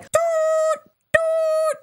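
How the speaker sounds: high, sustained horn hoot; drawn-out mock horn blasts; imitated horn toot. Imitates blowing the emergency horn.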